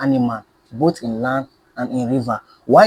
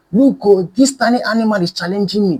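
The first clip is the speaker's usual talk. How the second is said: high, loud trumpeting yell; shout; 7 semitones higher, 5 dB louder than usual. A narrator's reading. Elephant shouting to all the animals in scorn. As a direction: bellow it to the whole savannah. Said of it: "blew trumpet and shouted".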